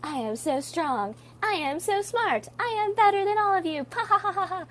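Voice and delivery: high-pitched, hammed-up boasting; over-the-top arrogant show-off; mock bragging. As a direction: gloat theatrically, ending in a haughty fake laugh. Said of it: "So when you brag, you're like".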